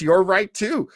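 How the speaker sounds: usual speaking voice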